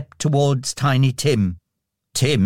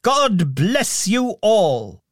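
joyful blessing; 7 semitones higher, much slower than usual. A narrator's reading. Scrooge blesses the street.

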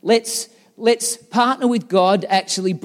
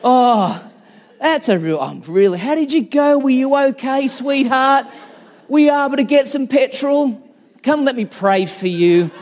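high, loud sugary concern; imagined sweet wife comforting him, hammed-up tender; mock sympathy; 7 semitones higher, 6 dB louder than usual. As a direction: ham up the doting wife you wished for. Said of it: "Like, where's the".

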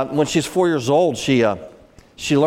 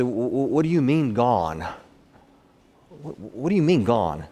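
stunned disbelief. Real, not acted. Stunned at the news of loss.

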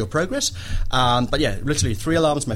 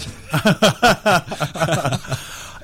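hearty laugh; 5 semitones higher than usual. Real, not acted. Man laughing after admitting an angry impulse, hearty laugh. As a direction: laugh out in a rapid, high run.

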